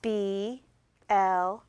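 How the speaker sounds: letter names held and separated; measured, stretched letters; spelling aloud together. Shown as a demonstration. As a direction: spell it out for a class, one letter at a time. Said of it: "Let's spell it together".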